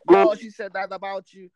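loud hyping call; loud mock of friends hyping; hyped friends.

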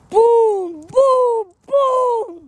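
loud, high rising booms; booming sound effect. Acted. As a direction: shout each boom like an explosion. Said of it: "Hey, that is my sound".

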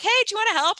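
put-on high-pitched mom-to-kids voice; retold coaxing invitation. Mom asking kids into the garden, high coaxing retold invitation.

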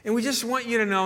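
earnest caller, voiced high. Caller to the pastor, high earnest plea. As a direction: lift your voice and plead your case. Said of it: "And he goes".

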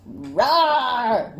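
loud playful roar, half laughing; fierce badger roar. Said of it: "Why the fierce badger?".

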